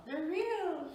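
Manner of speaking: squeaky high repeat of the words; excited. Shown as a demonstration. Showing off fresh strawberries, high and excited. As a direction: pitch it way up in delight.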